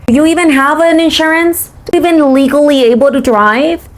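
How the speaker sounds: high, pointed questioning; retold condescending challenge. Challenging a teenage driver on her papers, high condescending retort.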